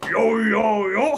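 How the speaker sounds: shouted mock-Cockney yo; thick Cockney. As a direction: bellow in an overdone Cockney. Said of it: "Cockney accents that are so thick".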